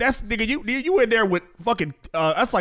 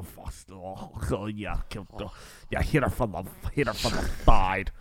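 low, breathy moaning accent put on; hammed-up aroused cuckold moaning in a low voice; Arabic cuck voice; 10 semitones lower, 7 dB quieter than usual. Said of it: "Arabic cuck voice".

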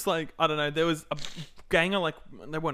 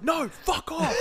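high-pitched mock scream; retold scream; 13 semitones higher, much slower than usual. Edgy friend screaming at a kid, high mock scream. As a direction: imitate a friend screaming a refusal. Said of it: "screaming at this kid".

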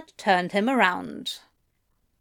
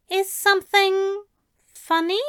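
high, puzzled question; puzzled Aziraphale; 9 semitones higher than usual. A narrator's reading. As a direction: ask it with gentle confusion.

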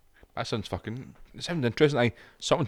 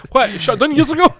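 much higher, mock surprise laughing; 7 dB louder than usual. Real, not acted. Mate joking about a closed shop, laughing mock surprise. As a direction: squeal the joke.

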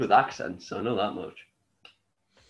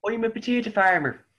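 higher mock Irish accent; 11 semitones higher, 4 dB louder, faster than usual. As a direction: play up a jokey Irish accent. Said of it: "like Ireland, I can do".

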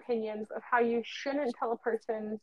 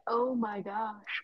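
slow realization; 6 dB quieter, much slower than usual. Retold or imitated. Realizing friend had a point.

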